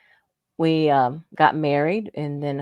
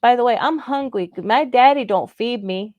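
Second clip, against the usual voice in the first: put-on little boy's talk; 5 semitones higher, 3 dB louder than usual. Mimicking a hungry three-year-old asking for food, little boy's talk. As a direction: put on a three-year-old's babyish speech. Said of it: "that's what he talked".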